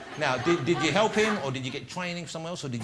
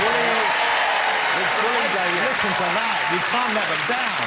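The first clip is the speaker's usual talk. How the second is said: loud, higher crowd-rousing call; rallying shout; 6 semitones higher, 9 dB louder than usual. Real, not acted. Host rallying the audience to bully David, loud and higher. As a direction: whip up the audience.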